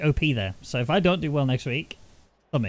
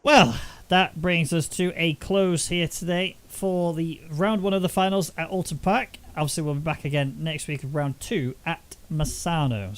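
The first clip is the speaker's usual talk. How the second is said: broadcast sign-off; 4 semitones higher than usual. Acted. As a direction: close the show like a broadcaster. Show outro.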